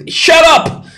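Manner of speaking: high, loud joking shout of shut up; mock shout. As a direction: playfully shout down a heckler. Joking shout at imagined heckler.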